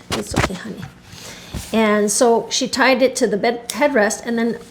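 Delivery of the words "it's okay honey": soothing whisper. Real, not acted. Soothing her old dog.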